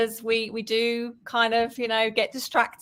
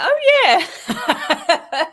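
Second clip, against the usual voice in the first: loud, high mock-dawning "oh, yeah"; sudden realisation; 8 semitones higher, 7 dB louder than usual. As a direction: act out the penny dropping, raised pitch. Retelling her sudden obvious realisation.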